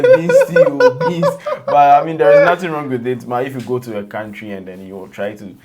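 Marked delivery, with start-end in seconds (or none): 0.0-1.3 high-pitched laughing squeal, louder; cracking up at a joke, high and loud. Real, not acted. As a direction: squeal the words through a laugh. Joking about the accent, laughing.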